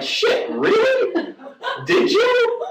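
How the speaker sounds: high, drawn-out astonished questions; shocked disbelief. Retold or imitated. Storyteller replays reacting to Joe's confession, mock shocked. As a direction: squeal it in mock amazement. Acting own astonished reply.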